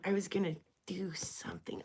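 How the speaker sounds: whispered to self; whispering. Real, not acted. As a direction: whisper it under your breath. Unvoiced whisper.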